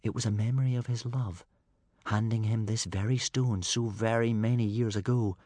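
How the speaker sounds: ordinary delivery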